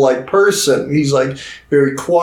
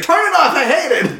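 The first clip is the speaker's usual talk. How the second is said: mock outburst; 12 semitones higher than usual. Singer hearing her own song in a taxi, mock outburst. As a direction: squeal it in exaggerated distress.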